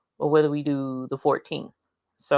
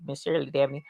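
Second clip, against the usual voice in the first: ordinary delivery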